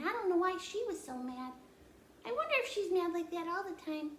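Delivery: put-on high, chirpy little girl; child puppet voice. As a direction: play the curious puppet child. Puppet Annie voice.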